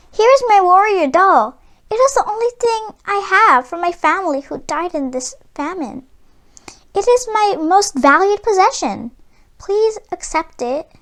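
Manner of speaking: put-on a child giving up what she loves most; pleading girl. A narrator's reading.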